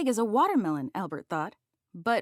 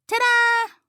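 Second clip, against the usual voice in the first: proud, much higher and louder ta-da; triumphant announcement; 14 semitones higher, 10 dB louder than usual. A narrator's reading. Little mouse unveiling his creation, triumphant ta-da. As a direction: present the big bag like a magic trick. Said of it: "Albert announced".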